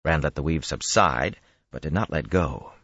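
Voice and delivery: ordinary delivery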